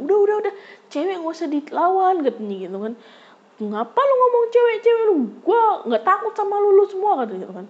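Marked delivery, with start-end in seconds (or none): 0.0-2.3 lightly voiced friend calming. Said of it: "kata temen-temennya". 3.6-7.2 put-on much higher, louder tough-girl yell; thuggish defiance. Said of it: "Gue preman banget ya".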